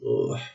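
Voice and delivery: low, quiet "ugh"; weary, revolted ugh under the breath; disgusted groan. Real, not acted. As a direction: groan softly, sickened by the film. Disgusted at the awful movie.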